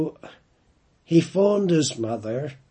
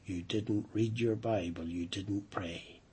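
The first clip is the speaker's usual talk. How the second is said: quiet reproach; 5 semitones lower, 9 dB quieter than usual. Retold or imitated. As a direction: let the truth land gently. Mother exposes son's lie.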